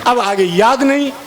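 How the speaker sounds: usual speaking voice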